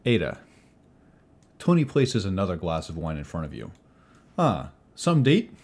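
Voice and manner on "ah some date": high, pushy barkeep voice, much louder; jovial innkeeper. Acted. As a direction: fuss over guests like an overeager innkeeper. Innkeeper Tony greets guests.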